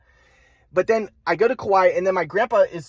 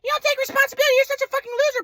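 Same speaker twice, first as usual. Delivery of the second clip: much higher mocking mother; mock mom voice; faster than usual. Son mocking his mother's insults on the phone, high-pitched. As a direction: parody mom's insults in a high voice. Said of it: "She's like".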